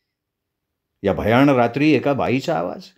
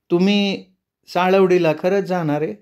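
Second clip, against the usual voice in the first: higher, serious now; somber village woman; 6 semitones higher than usual. Acted. The woman turns grave, asking if he really goes there. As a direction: ask it gravely.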